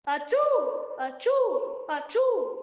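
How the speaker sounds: big sneezes, high and loud. Acted. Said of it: "louder and louder".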